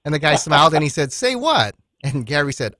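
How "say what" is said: high, surprised mock question; puzzled stranger. Stranger confused by Gary's teasing, high and surprised. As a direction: jump up high, baffled.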